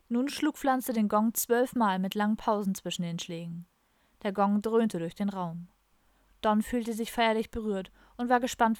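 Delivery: ordinary delivery, nothing marked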